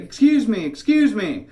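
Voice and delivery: loud, high imitation of a yelling woman; high-pitched, insistent retold shout; screamed call. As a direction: mimic a lady screaming for attention. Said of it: "again, she screamed".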